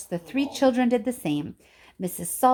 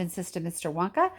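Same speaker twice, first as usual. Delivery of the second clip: ordinary delivery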